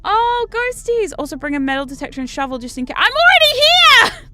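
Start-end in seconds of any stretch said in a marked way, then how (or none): 0.0-1.1 high, slow, gushing delight. Real, not acted. Gamer cooing at the new airport, high and slow. 3.0-4.3 high, loud exasperated squeal; squeaky shout. Real, not acted. Gamer yelling back at the game's tip, high squeal.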